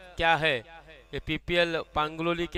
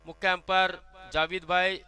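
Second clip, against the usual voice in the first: usual speaking voice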